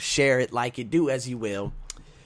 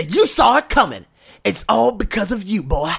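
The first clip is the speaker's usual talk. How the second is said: loud, higher taunting of the boss; mock gloating; 5 semitones higher, 7 dB louder than usual. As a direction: rub it in his face.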